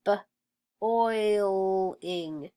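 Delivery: short "b", pause, drawn-out "oiling"; sounded out. Shown as a demonstration. Teacher sounding out boiling for pupils, sounded out. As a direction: separate the first sound from the rest.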